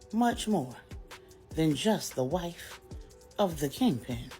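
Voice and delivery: usual speaking voice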